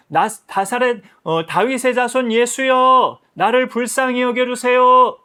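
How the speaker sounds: loud, slow, high plea to Jesus; crying out. Acted. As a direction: call out loudly and slowly, begging for mercy. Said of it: "소리를 치기 시작했어요". (큰 소리로 천천히 외치며 간청)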